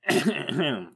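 theatrical throat clear; pompous ahem. A narrator's reading. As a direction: clear the throat before the tale. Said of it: "He made a throat-clearing sound".

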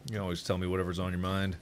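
very low, soft and quick; hushed reassurance. Pretend. Promising a friend he will always listen, hushed. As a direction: murmur it like a close confidant.

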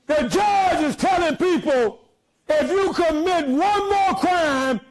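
shouted sermon climax; hollering preacher build; preaching at full force. Acted. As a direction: whip up the church.

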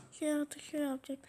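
quick whisper. Real, not acted. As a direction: whisper while searching the word. Whispers forgotten word.

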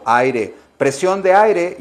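normal talking voice

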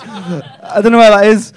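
normal talking voice

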